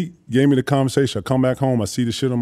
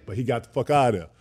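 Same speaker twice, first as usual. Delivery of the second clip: ordinary talk, nothing marked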